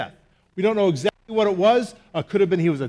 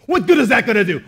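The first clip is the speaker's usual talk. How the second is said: louder, doubtful retort; sceptical thought; 3 semitones higher, 7 dB louder than usual. Retold or imitated. Pastor voicing his doubt about his wife's prayer. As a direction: scoff at the idea of prayer. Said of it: "my initial thought was".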